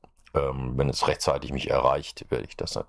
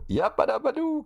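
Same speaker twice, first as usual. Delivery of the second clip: high, squealing cartoon catchphrase; Fred Flintstone catchphrase. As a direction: belt it out like a cartoon caveman. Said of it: "Fred Feuerstein war das".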